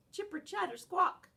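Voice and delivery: squirrel chatter. Acted. Squirrel noise heard by dog.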